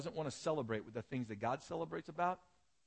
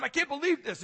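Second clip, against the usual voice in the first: much higher and louder, furious outburst; angry yell; 13 semitones higher, 8 dB louder than usual. Retold or imitated. Older brother yelling in the courtyard about the party, furious. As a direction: let the brother's outrage explode. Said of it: "he's yelling".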